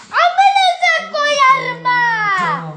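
long high drawn-out crying shout; crying wail. Real, not acted. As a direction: wail it out slowly, sobbing. Drugged boy wailing.

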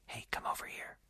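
faint breathy whisper. Pretend. Jupiter whispering to Earth from far away. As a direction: barely whisper the call from afar. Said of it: "It's just a little whisper".

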